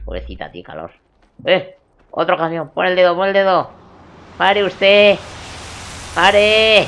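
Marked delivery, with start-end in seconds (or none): 1.4-6.9 full yell to stop the truck; high, loud desperate hail; shouting. Acted. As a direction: bellow to flag the driver down.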